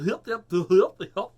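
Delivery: high, loud cartoon hillbilly yelps; mock cartoon hoedown voice; Looney Tunes hillbilly. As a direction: yelp it like a cartoon country character. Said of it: "Looney Tunes kind of".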